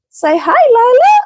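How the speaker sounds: sing-song high voice for the dog; cooing at a pet; high dog-talk voice. Real, not acted. Talking to the dog.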